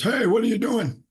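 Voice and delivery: high, loud imitated challenge; older store worker. Older Walmart worker to customer at paint chips, challenging. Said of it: "walks up and says".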